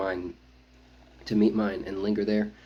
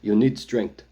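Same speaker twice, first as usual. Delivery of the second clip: louder, gruff push to eat; firm, louder warrior voice; gruff urging; 8 dB louder, slower than usual. A narrator's reading. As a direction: insist like a blunt warrior cousin. Zolesh urging his cousin.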